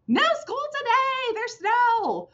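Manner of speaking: teacher demonstrating an excited exclamation. Shown as a demonstration. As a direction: announce it like great news. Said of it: "Do you see how I'm really excited about everything".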